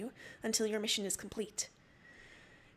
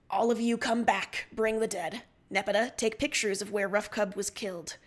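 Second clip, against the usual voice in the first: loud, firm orders over the comm; commanding order; 5 dB louder than usual. Acted. Captain orders away team back, loud command. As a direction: bark out orders to the crew. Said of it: "you order".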